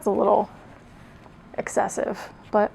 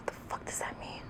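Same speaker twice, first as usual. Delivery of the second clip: breathy whispered aside under the breath; 20 dB quieter, faster than usual. Real, not acted. Reader puzzling over her old writing, whispered. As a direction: mutter it to yourself, voiceless. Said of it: "aside while reading".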